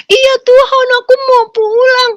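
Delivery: put-on high, loud little-child voice; pleading like a child to her father; childlike plea. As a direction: answer like a small scared child. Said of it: "kita tuh kayak anak kecil".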